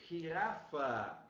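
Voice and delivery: Girafa said slowly with a throaty Spanish start; Spanish jirafa demo. Shown as a demonstration. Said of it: "olha aqui, ó".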